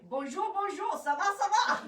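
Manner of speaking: high, loud hammed-up French accent greeting; mock French accent. Fashion host greeting viewers live in a hammed-up French accent. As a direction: play the over-the-top French visitor. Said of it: "wanting to speak in this accent".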